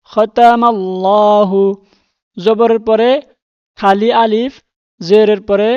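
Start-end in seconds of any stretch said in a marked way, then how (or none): 0.1-1.6 খতামাল্লাহু said slowly with a clear final হু; slow tajweed demo. Shown as a demonstration. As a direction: draw out খতামাল্লাহু, ending on হু. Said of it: "হা পেশ হু". (ধীরে খতামাল্লাহু, শেষে হু স্পষ্ট)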